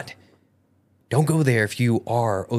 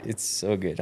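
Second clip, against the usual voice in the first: usual speaking voice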